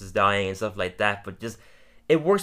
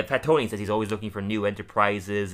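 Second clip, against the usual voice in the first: ordinary talk, nothing marked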